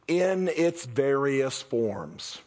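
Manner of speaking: very slow, weighing each word; slow emphasis. Acted. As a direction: spell it out slowly. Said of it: "the words I really wanna focus on".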